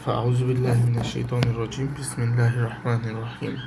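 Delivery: softly intoned opening prayer formula; formal Arabic invocation. Acted. Teacher opening the class with a recited Arabic blessing. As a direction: recite the blessing quietly before the lesson.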